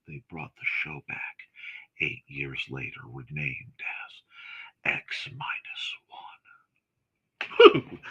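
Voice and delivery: very low, hushed voice, almost whispering; hushed reveal. Real, not acted. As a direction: drop to a slow, near-whispered reveal. Dramatic reveal about old show.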